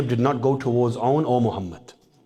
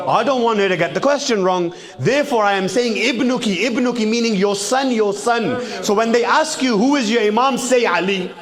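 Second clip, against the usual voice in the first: high, loud preaching build; impassioned preaching; 9 semitones higher, 4 dB louder than usual. Acted. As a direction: preach it out to the crowd, full voice. Preacher rising to full force.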